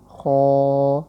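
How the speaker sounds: خو held long and loud; drawn-out letter name. Shown as a demonstration. Teacher spells for students, long loud خو. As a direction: stretch خو out as in spelling. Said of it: "آسان ہے، خو".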